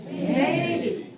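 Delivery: "Maybe" with level "may" and dropped "be"; low ending. Shown as a demonstration. Teacher repeats for students, "Maybe" ending low. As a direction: let "be" fall in "Maybe". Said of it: "And the B, you must be very low".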